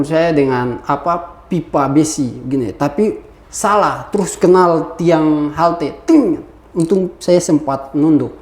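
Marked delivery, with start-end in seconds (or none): 6.0-6.4 high, falling "ting"; sharp vocal clang; imitated metal clang. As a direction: mimic a pipe ringing off a pole. Imitates pipe striking the pole.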